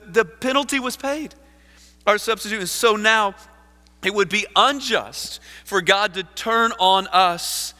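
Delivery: high, loud, urgent pulpit delivery; fervent preaching. Acted. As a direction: drive the argument home at full force. Preaches quotation at full force.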